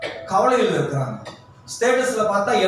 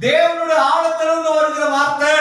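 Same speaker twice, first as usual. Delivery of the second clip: fiery preaching; 8 semitones higher than usual. Acted. Pastor urging the congregation to trust God, fiery preaching. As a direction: preach it hard and fast to the crowd.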